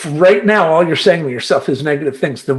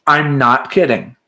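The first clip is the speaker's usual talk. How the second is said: ordinary talk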